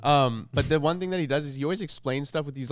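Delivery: ordinary talk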